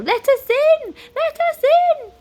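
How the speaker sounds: very high, loud frantic cries; panicked shouting. A narrator's reading. Wizard pounds on the door, panicked shouting. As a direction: yell desperately to be let in.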